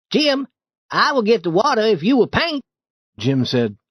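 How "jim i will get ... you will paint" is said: put-on high, eager boy's voice; coaxing boy Tom. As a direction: sweet-talk him into the chore. Tom coaxes Jim.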